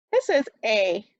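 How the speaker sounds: usual speaking voice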